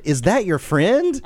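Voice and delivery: put-on high, slow curious kid voice; a lightly voiced child. A father imitating his son asking about a picture, high and slow.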